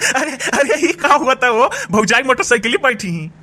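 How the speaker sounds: high voice breaking with laughter; amused teasing. Acted. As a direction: talk through the tail of a laugh. Laughs at sister-in-law on motorcycle. (हँसते-हँसते चिढ़ावत)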